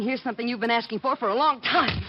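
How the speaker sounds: loud, pointed kiss-off; defiant payback. Acted. Camera girl quitting on her boss, loud and defiant. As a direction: deliver the slap line with relish.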